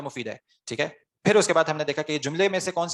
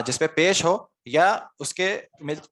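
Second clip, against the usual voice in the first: slow dictation; much slower than usual. Shown as a demonstration. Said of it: "teacher slowly gives the answer".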